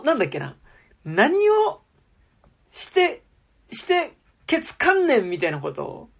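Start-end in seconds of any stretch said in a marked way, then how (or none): 1.0-5.3 high, halting imitation of Kansai anger; Kansai-dialect outburst. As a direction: act out a Kansai telling-off, piece by piece. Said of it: "急に関西弁で切れるんですよ". (関西弁でキレる真似、途切れ途切れに)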